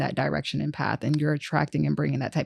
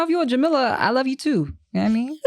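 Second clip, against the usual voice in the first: normal talking voice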